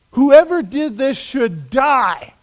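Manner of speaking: loud, slow, furious verdict; a king's outraged sentence, hammered out slowly; incensed outburst. Acted. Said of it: "David gets incensed".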